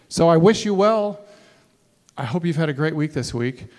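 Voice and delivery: ordinary delivery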